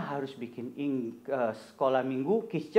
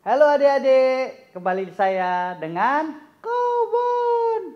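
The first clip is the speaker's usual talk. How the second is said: excited greeting; 10 semitones higher, 7 dB louder, slower than usual. Real, not acted. Cheerful greeting to the kids.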